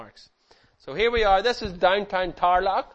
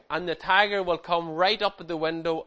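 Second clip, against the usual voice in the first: normal talking voice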